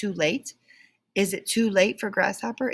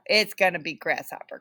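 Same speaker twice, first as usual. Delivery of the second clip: plain reading voice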